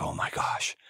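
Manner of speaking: breathless whispered gasp of amazement; awed crowd whispering; hushed awe. Retold or imitated. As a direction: whisper it like the crowd just realized. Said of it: "They're like".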